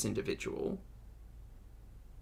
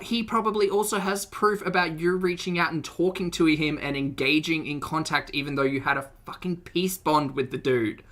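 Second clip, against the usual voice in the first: usual speaking voice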